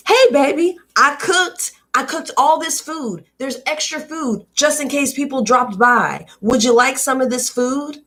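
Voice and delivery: high, sugary mom offering food to guests; a mother sweetly offering food in a hostess voice. Retold or imitated.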